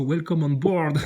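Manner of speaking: ordinary talk, nothing marked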